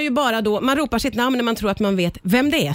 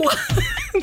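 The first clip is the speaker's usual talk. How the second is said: squealing high laugh; high burst of laughter. Real, not acted. Host laughing at Marika calling herself number two, high squeal.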